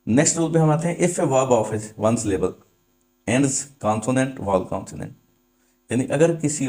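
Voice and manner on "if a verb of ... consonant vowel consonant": very slow, word by word; slow dictation. Shown as a demonstration. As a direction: dictate the rule for notes. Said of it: "dictating rule slowly". (धीमे-धीमे लिखवाना)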